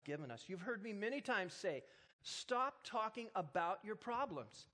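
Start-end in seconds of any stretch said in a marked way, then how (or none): 2.2-4.7 full-force preaching. Acted. Pastor urges the congregation to command their problems, fervent preaching. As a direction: drive it home to the crowd.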